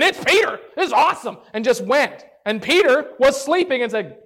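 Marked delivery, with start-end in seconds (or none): 0.0-1.4 excited joy. Retold or imitated.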